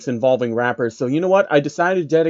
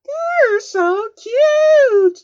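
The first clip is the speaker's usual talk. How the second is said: very high, very slow squeaky pony coo; hammed-up high cooing pony; Fluttershy; 4 dB louder, much slower than usual. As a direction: gush over the cute creature. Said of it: "she said".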